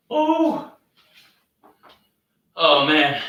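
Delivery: loud, high winded oh man; winded exclamation. Real, not acted. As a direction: gasp it out, spent. Reacts after final set.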